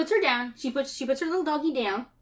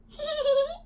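put-on high childlike hee-hee; little girl's giggle. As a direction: giggle like a mischievous little girl. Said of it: "and Sandy goes".

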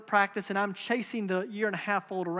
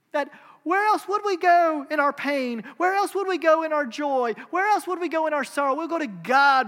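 higher, louder repeated questions; fervent preaching; 5 semitones higher, 6 dB louder than usual. Real, not acted. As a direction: build the appeal with fire. Rising rhetorical preaching climax.

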